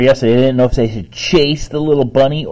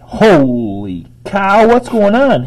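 much higher and louder, slow on holy cow; excited exclamation; 10 semitones higher, 7 dB louder, slower than usual. A narrator's reading. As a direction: burst in amazed. Boy bursts into kitchen.

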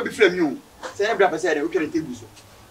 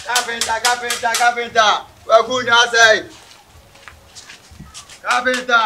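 calling loudly from outside; 3 semitones higher, 4 dB louder than usual. Acted. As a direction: call out for the carpenter. Calling at the carpenter's door.

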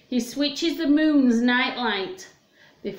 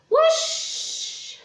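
a sudden high breathy whoosh of a flying saucer; high airy whoosh. Acted. Teacher making the saucer fly away for the kids. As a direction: whoosh it up like a rocket taking off. Rocket sound in song.

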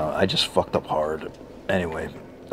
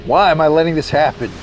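incredulous outrage; 8 semitones higher than usual. Real, not acted. Dies to same enemies again.

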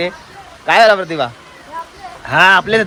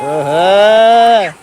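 drawn-out loud oho; 7 dB louder, much slower than usual. Real, not acted. Whooping at heavy rain.

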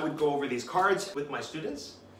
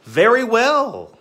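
high, loud and slow "Very well"; proud cheerful; 10 semitones higher, 13 dB louder, much slower than usual. Shown as a demonstration. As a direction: show off a confident answer. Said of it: "proud answer demo".

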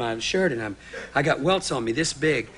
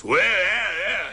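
loud, raised bear bellow; imitated bear roar; 3 semitones higher, 4 dB louder, much slower than usual. Guest acting out the bear hearing its cue, imitated bear roar. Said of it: "The bear goes".

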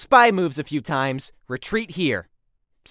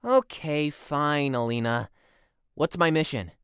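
low, soft, grudging give-in; deflated and quietly resigned; grudging compliance; 5 semitones lower, 7 dB quieter than usual. Acted. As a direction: give in reluctantly, voice dropped. Scolded agent gives in.

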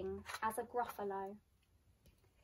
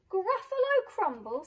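horrified gasp; 9 semitones higher, 8 dB louder than usual. Acted. Gruffalo horrified.

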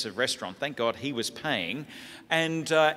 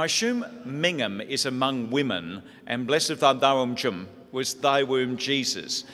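ordinary talk, nothing marked

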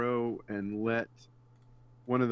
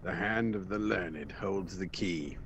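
game oracle voice; 4 semitones lower than usual. Pretend. Reading game text in voice.

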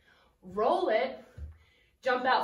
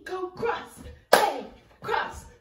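normal talking voice

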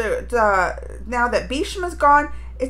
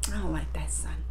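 lower, secretive whispered aside; 10 semitones lower, 11 dB quieter than usual. Real, not acted. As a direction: confess it in a hush. Confiding dislike aside.

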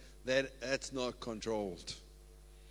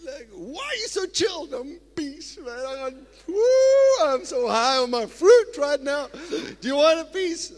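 much higher and louder, dreamy ecstatic voice; blissed-out believer; 11 semitones higher, 11 dB louder than usual. Acted. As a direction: play someone high on spiritual joy. Plays a blissed-out fruit eater.

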